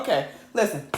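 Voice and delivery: ordinary talk, nothing marked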